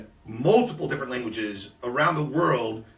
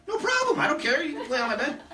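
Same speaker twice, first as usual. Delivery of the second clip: mock easygoing; 11 semitones higher than usual. Retells own breezy reply to guests.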